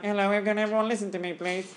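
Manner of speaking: a thin, timid teacher voice, trailing off softly; weak teacher voice. Shown as a demonstration. Teacher showing students how not to sound, meek and quiet. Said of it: "If you sound like".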